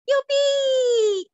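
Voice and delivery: big happy yippee; gleeful cheer. Acted. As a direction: cheer like a delighted child. Cheering the horse's return.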